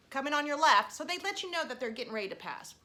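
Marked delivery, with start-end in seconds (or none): 0.1-0.9 louder cyclist's warning call; polite call-out. Retold or imitated. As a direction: call out to warn a walker ahead. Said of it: "polite by saying".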